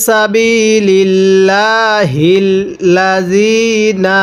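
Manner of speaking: সাবিলিল্লাহিল্লাযিনা with the ya sound held one alif long; drawn-out ya. Shown as a demonstration. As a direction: stretch the যি one alif length. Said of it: "তাহলে কিভাবে পড়ব?".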